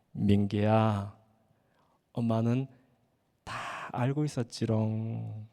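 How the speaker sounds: low, slow, playful mom's tease; teasing mother. Acted. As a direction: drawl it out like a mother who knew all along. 엄마가 다 안다며 놀림. (낮고 느리게 놀리듯 말하는 엄마)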